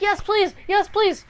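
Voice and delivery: mock-eager man jumping at an offer; eager acceptance. Lovesick man eagerly accepts potion.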